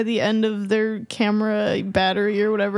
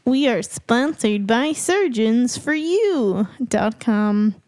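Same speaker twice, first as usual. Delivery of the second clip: slow, higher hammed-up ad read; mock commercial; 4 semitones higher, slower than usual. [name] does a fake ad for the podcast break, as a hammed-up infomercial. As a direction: sell it like a cheesy infomercial. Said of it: "We're sponsored".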